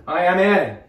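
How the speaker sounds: ordinary talk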